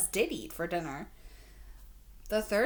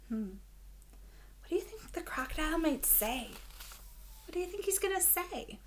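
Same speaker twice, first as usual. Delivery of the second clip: ordinary delivery, nothing marked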